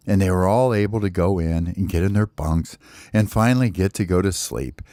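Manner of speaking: ordinary delivery, nothing marked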